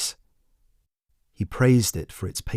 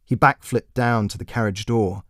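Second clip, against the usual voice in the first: ordinary delivery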